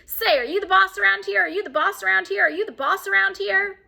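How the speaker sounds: high, loud parrot squawk. Acted. Parrot squawks at the mother, high and loud. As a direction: screech it like a cheeky parrot.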